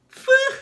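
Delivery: high, loud exaggerated phew; loud relieved phew. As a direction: blow out big relief. Spat out by shark.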